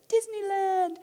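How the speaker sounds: high, loud cheer imitating an excited kid; high-pitched hammed-up excited squeal; mock excitement. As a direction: sing it out with pretend glee. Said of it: "We thought she'd be so excited".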